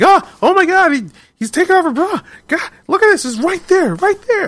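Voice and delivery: very high squeaky mock panic; retold freaking out. Fan retelling kids seeing a racy comic, squeaky freak-out. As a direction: imitate overexcited kids squealing in shock. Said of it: "freaking out".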